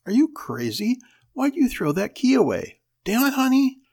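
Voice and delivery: high, loud furious outburst; husband raging at his wife for tossing the key; blowing up. Acted. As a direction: explode at her in disbelief. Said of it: "Henry blew up too".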